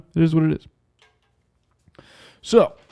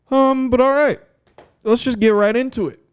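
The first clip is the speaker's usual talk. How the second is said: upbeat launch; 11 semitones higher than usual. Real, not acted. Launching into the topic.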